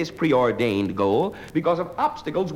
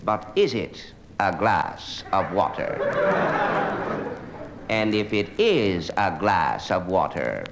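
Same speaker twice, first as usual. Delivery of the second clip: slow, low philosophical pondering; pseudo-intellectual student; 5 semitones lower, slower than usual. Acted. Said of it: "University of Chicago student, I will describe him for you".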